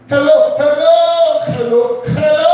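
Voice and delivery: high, drawn-out mock Indian accent; hammy Indian-accented phone hello, stretched out; worse Indian accent. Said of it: "speak with a worse accent".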